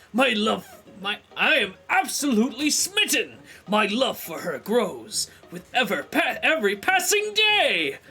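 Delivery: proper reading voice. Acted. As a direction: declaim it like a smitten knight. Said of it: "I need to read this with a proper voice".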